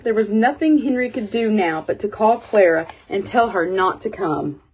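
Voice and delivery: usual speaking voice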